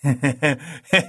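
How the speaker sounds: a short, delighted burst of laughter; pleased chuckle at breakfast; happy laugh. Acted. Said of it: "بابک با خوشحالی خندید".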